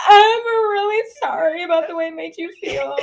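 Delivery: hammed-up teary non-apology; fake apology. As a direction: wail an insincere apology.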